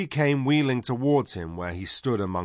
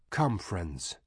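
soft voice; 5 dB quieter than usual. Acted. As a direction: speak softly to the gathered friends. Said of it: "said Sepel in his soft voice".

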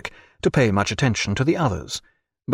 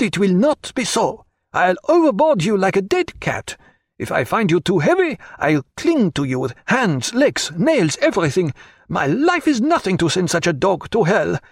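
furious French cry; 9 semitones higher than usual. A narrator's reading. Pontécanet rages at Jack over the chessboard. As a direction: explode in French-accented rage. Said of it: "words came tumbling so fast ... strange an accent".

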